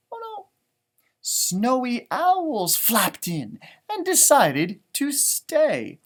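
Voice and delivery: slow, clear picture book reading; storybook read-aloud voice; reading to children. Acted. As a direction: read it out slowly for little listeners. Reads book text to children.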